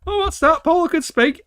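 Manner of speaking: hammed-up astonishment; mock surprise. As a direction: squeal it like sudden disbelief. Mock shocked reaction.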